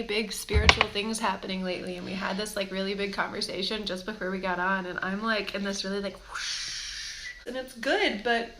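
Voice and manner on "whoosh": unvoiced whoosh sound; a soft rushing breath sound; breathy whoosh. Real, not acted. Describing spiritual rush.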